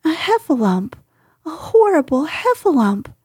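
high, panicky piglet; frightened cry. Acted. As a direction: blurt it out in alarm. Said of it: "cried Piglet".

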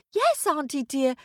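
high-pitched answer called back; dutiful little girl. Acted. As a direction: call back like an obedient niece. Girl answers witch, hiding fear.

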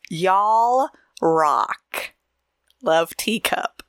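a light Southern twang on "Y'all"; a light Southern accent on "Y'all rock"; mock Southern y'all. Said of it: "the way you said y'all".

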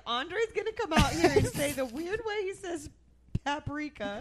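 laughing retelling. Retold or imitated. Friend telling what they said while watching, laughing. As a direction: giggle out the retold prediction. Retelling own prediction, cracking up.

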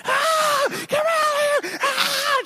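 very high-pitched panicked wife's scream; mock screams from a collapsing tent; imitated screaming. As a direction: shriek in imitation of a claustrophobic wife. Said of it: "like, screaming".

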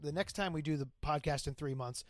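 ordinary talk, nothing marked

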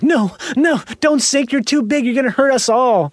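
high, pleading "No, no, don't sink"; panicked pleading. Retold or imitated. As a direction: beg the ship not to sink. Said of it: "It's like a ship sinking".

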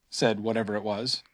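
plain reading voice

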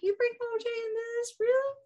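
high, fast incredulous questions; hammed-up baffled objection; mock disbelief. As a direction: squeak out amused disbelief, quickly. Mock disbelief at Randy's examples.

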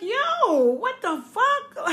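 high-pitched, stretched-out mock disbelief; bewildered calling. Kids watching mother walk away, bewildered high call. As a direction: act out a baffled kid calling out.

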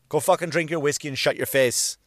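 usual speaking voice

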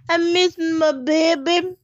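high, drawn-out mock pining; mock missing-baby wail. Imitating a contestant missing her baby, mock wail. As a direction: stretch each word out in exaggerated longing. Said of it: "Natasha talks about missing her baby".

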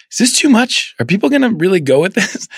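high anxious squeak of doubt; fretful high-pitched second-guessing; mock panicky doubt. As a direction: play your own worry, hammed-up and high. Retelling own last-minute doubt.

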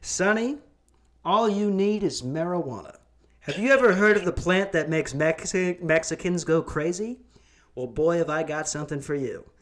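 put-on high, folksy old-timey pitchman; 1950s narrator. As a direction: sell it like a vintage commercial. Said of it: "I could have been the pot dealer".

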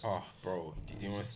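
normal talking voice

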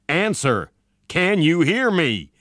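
insistent shout into the radio; calling out. A narrator's reading. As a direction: call for an answer, pressing each word. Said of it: "called General Dane".

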